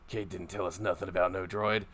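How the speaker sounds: lightly put-on suspicious guard voice; stronghold guard. Guard questions the driver about the droid delivery, suspicious. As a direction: question them like a wary henchman. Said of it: "two of the four guards".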